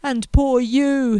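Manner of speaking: slow, loud self-pitying wail; theatrical wail of self-pity. Acted. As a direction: moan it out, drawn long and loud. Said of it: "he wailed".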